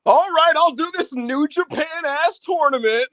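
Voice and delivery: high, slow, lazy mock Max voice. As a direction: ham up a bored star reluctantly agreeing, high and slow. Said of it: "could you imagine Max being in and being like".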